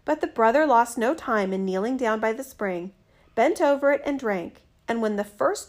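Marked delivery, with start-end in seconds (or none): none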